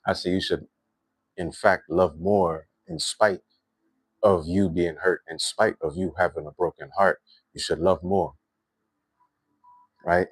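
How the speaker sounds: normal talking voice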